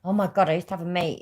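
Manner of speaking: usual speaking voice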